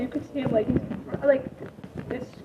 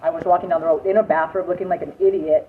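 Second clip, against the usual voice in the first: normal talking voice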